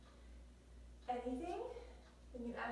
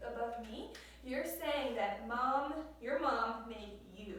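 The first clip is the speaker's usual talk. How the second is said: normal talking voice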